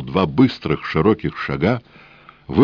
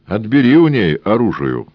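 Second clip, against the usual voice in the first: loud higher command; barked order; 6 semitones higher, 5 dB louder than usual. A narrator's reading. Policeman orders the fop to disarm her, loud. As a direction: order the fop to grab the gun. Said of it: "приказал городовой".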